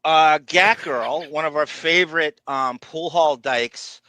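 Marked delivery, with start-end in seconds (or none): none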